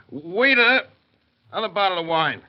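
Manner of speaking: loud high call across the tavern. Acted. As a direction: summon the waiter loudly. Drunk calling for a waiter.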